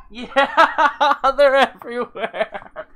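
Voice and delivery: high, loud laughing through words. Real, not acted. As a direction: laugh at the mess. Laughing at the mess.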